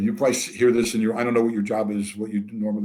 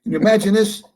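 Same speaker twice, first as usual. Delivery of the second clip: loud, high-pitched indignation; mock outrage; 9 semitones higher, 7 dB louder than usual. Storyteller to audience about being cursed by a new client, mock outraged. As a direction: exclaim it in incredulous, hammed-up offence.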